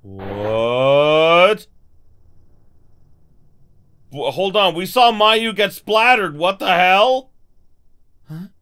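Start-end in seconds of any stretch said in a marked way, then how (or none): 0.0-7.3 loud stunned disbelief; shocked. Real, not acted. Player shocked at seeing Mayu splattered, loud stunned reaction. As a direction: react as if you just saw something horrible.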